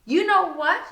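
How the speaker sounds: loud, high challenge; snapping back. Acted. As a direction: throw it back at her. Narrator snaps back at Regina.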